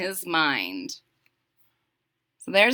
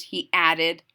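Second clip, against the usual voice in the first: plain reading voice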